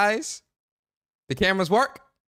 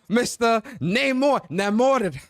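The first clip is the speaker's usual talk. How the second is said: high, loud announcer hype, dragging out the titles; bigging up your bro like a ring announcer; hyped arena-style introduction of his co-host; hype-man introduction; 10 semitones higher, 4 dB louder, slower than usual. Acted.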